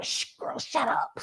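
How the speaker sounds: whispered shush; hushing whisper. Retold or imitated. Grandma shushing the girl in church, whispering. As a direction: hiss a hush at the kid. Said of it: "my grandma going".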